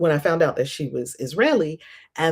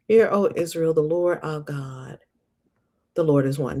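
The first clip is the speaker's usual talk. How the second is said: reading aloud. Acted. Reading the verse.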